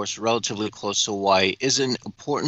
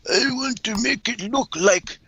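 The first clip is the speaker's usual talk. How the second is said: mock world leader voice; 8 semitones higher than usual. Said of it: "Putin and Joe are like".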